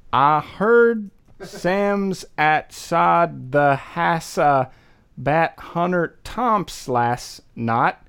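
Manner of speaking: slow sounding out of Bat Hunter Tomps and Earl Canny Famgins; slow phonetic read of thick Baltimore slang; reading Baltimorese phonetically. Shown as a demonstration. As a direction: read the strange spelling slowly, word by word. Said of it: "you're gonna have to pronounce it for us".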